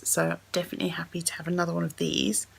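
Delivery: ordinary delivery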